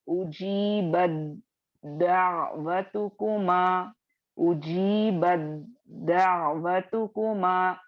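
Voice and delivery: "उजीबत दावतुकुमा" slowly, with ता merged into दाल; Quran recitation demo. Shown as a demonstration. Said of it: "इसको आप कैसे पढ़ेंगे आप?". (धीमी तिलावत, ता को दाल में मिलाकर)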